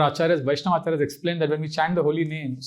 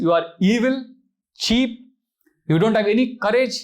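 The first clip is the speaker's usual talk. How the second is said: higher, louder contempt; Sita scolding Ravana; 6 semitones higher, 4 dB louder than usual. Acted. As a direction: pile the insults on with scorn. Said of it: "She says".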